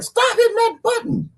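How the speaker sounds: high squeaky mock complaint; high mock complaint from an annoyed viewer. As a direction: play an irritated viewer, high and laughing. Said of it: "Somebody is pissed off, like".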